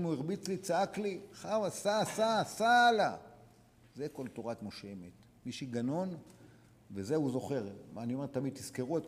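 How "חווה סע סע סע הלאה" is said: loud, higher, slow call to move on; emphatic urging. Acted. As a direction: drive each סע home, slowly. Voicing advice to a wronged man.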